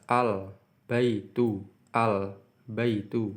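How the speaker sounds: "Al-baitu" said slowly with the l clearly sounded before b; clear l demo. Shown as a demonstration. Teacher showing students a clearly read l, slow and careful. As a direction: spell out "al-baitu" slowly, l fully heard. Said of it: "maka dibaca jelas".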